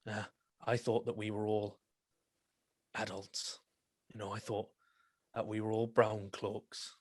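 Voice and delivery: the captain quietly mocks the recruits as children; cold sarcastic scolding. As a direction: let the contempt sit in a quiet voice.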